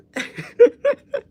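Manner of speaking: loud, very high laughing fit; high squealing laugh. Real, not acted. Telling viewers about Craig, squealing high laughter.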